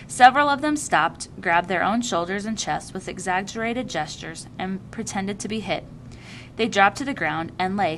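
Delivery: ordinary delivery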